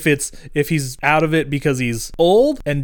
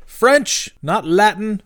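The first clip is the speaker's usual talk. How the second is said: stern correction, pitched up and pushed out; Brother Baxter; 6 semitones higher, much slower than usual. Retold or imitated. Headmaster corrects the drunk teacher, stern mock voice. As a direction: snap the correction at the old teacher. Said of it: "he's like".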